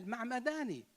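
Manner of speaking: ordinary delivery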